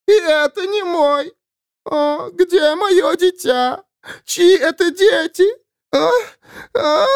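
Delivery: high howling wail. Acted. Dead woman howls searching for her child. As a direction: howl it like a grieving ghost. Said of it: "выла она".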